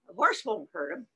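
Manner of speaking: ordinary delivery, nothing marked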